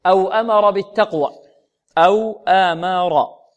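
أمر بالتقوى loud with heavy ra; recited loud. Shown as a demonstration. Teacher reciting the verse for students. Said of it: "أو هذه مرققة كلها".

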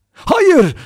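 high, loud shout of denial; outraged cry. A narrator's reading. Vera denies Lombard's accusation, crying out loudly. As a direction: cry out sharply, pitch leaping up. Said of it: "diye haykırdı Vera".

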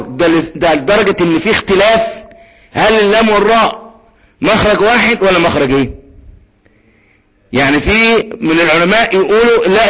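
ordinary delivery

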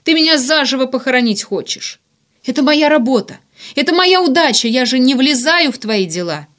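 high, loud, building appeal; desperate pleading. A narrator's reading. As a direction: beg with mounting indignation. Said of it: "взмолился Варданян". (отчаянная мольба на повышенных тонах)

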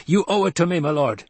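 high-pitched, heated insistence; wronged retainer pleading angrily; angry demand. A narrator's reading. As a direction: demand what is owed, in a rage. Enraged retainer demands his promised revenge.

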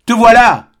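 high, loud cry of joy; trembling cry. A narrator's reading. Albine cries out to Serge, loud and high. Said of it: "cria-t-elle tout tremblant".

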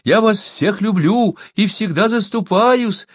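high, eager flattery; storyteller voicing a wheedling coward; ingratiating. A narrator's reading. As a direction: plead high-voiced to win them over. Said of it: "Льстиво оглядывая толпу".

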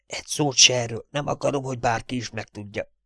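soft conspiratorial warning; furtive whisper. Acted. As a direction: swear someone to secrecy under your breath. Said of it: "majd rekett hangon suttogta".

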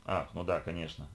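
low, soft "oh, of course"; understated realising tone; sudden recollection. A narrator's reading. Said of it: "опомнилась Лиша".

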